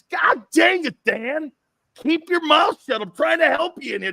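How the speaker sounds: high, loud exasperated shouting; coach yelling. Retold or imitated. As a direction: holler at a mouthy player to shut up. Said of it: "I remember you going like this".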